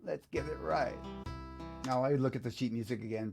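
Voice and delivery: normal talking voice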